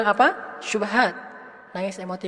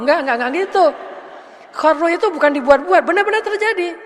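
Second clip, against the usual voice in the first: louder, higher, insisting it cannot be faked; emphatic insistence; 7 semitones higher, 6 dB louder than usual. Real, not acted. Preacher insists to the congregation, loud and emphatic. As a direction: push back hard on the fake swoon.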